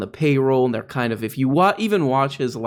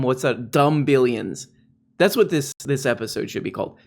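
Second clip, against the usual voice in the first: usual speaking voice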